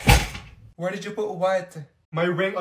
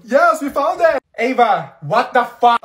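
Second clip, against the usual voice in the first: loud; 3 semitones higher, 8 dB louder, slower than usual. Acted. Phone found, exasperated.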